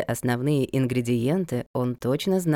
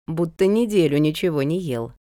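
usual speaking voice

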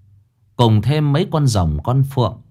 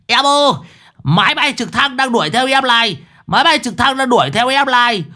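madman's yell; 13 semitones higher than usual. A narrator's reading. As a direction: holler after the girl like a lunatic. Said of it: "nó hét".

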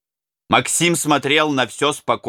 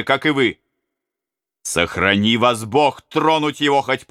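rallying commander; 5 semitones higher than usual. Acted. Prince rallies the robbers.

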